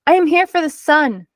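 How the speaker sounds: shouted. A narrator's reading. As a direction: call it out over the wall. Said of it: "Usha shouted".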